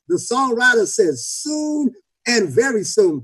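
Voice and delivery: rousing sermon build with stretched key words; full-force preaching. Real, not acted. Preacher urging the congregation not to give up, full-force preaching. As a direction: drive it home to the congregation.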